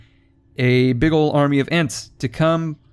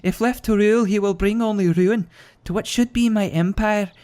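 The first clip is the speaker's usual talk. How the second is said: higher Scottish woman's voice; 8 semitones higher than usual. Retold or imitated. Gamer reading the quest giver's regicide plea in Scottish. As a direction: read it as a scheming Scottish princess. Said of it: "apparently it's a Scottish woman".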